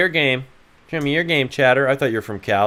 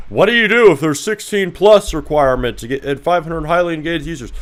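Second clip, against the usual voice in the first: high, louder whiny mock of a chat question; 7 semitones higher, 6 dB louder than usual. Streamer mocking a viewer's question in a silly voice. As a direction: parrot the dumb question back in a silly voice.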